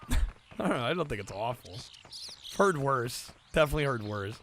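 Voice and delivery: ordinary talk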